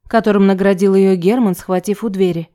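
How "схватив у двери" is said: usual speaking voice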